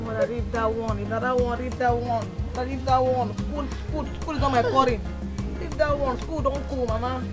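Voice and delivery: low, soft dismissive protest; muted brush-off. Acted. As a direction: keep it down while waving Mama off. Daughter brushes off crying mother.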